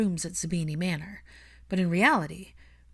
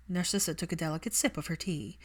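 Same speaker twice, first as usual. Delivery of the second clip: ordinary delivery, nothing marked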